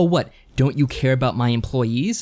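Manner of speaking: ordinary delivery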